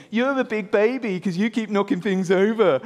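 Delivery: taunting, sing-out sibling tease; mocking sister. Agnes laughing at her clumsy brother, mocking. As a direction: make fun of him, playground style. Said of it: "Agnes starts laughing at him".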